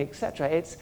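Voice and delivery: ordinary talk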